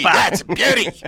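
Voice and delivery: hammed-up Aussie accent. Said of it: "a bit of the Aussie accent".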